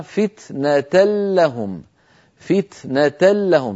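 slow recitation of "فتنة لهم", the tanween merged into a doubled lam; recited with idgham. Shown as a demonstration. Teacher reciting the tanween example slowly with merged nun. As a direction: say "فتنة لهم" as "fitnatal-lahum", no n sound. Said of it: "كما نرى أمامنا".

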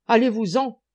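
furious bellowing giant; bellowed. A narrator's reading. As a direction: bellow him away. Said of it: "beugla le colosse".

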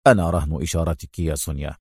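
low, quick, cool reply. A narrator's reading. Adham answering impatient Sonia, coolly. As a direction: answer coolly, soft and unbothered. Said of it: "وقال في برود".

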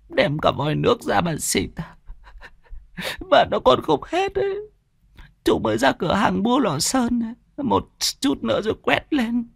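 acted tearful lament, rising into a high wail; tearful mother. A narrator's reading. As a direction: sob through the words as a grieving mother. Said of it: "lại sụt sùi". (giọng người mẹ sụt sùi, nghẹn ngào)

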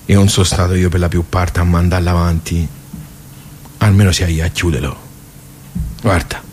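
put-on old man closing the club for the last time, softly; quiet resolve. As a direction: say goodbye to the place gently.

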